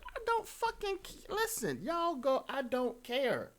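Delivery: very high, slow mock protest; exaggerated exasperation. Caller insisting they don't care, exaggerated exasperation. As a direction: stretch it out in a squeaky put-on voice. Acted protest of not caring.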